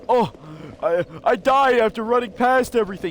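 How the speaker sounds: high-pitched mocking gripe; mock complaining critic. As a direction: imitate a player whining about dying, pitched well up. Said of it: "That is my problem".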